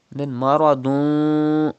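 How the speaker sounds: maradun slow, the final n hidden and nasal; ikhfa ghunna. Shown as a demonstration. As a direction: slowly hum the tanween of maradun. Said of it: "You can see fa ikhfa letter after tanween".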